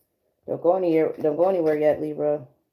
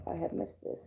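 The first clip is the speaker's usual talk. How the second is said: soft heartfelt aside; 2 semitones lower, 15 dB quieter than usual. Real, not acted. Heartfelt aside, almost whispered.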